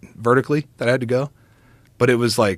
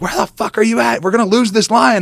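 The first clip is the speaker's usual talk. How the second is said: high-pitched exasperated call; impatient yell; 11 semitones higher than usual. Retold or imitated. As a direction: snap it out high, frantic not to lose the lion. Friend impatient on phone.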